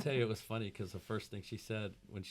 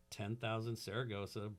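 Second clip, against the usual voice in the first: ordinary talk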